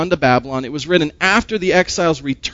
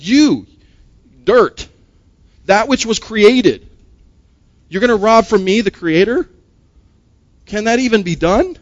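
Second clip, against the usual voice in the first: higher and louder, incredulous; higher, louder divine rebuke; God's challenge; 4 semitones higher, 4 dB louder than usual. Acted. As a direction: voice God confronting the dirt-made man. Voicing God's challenge to man.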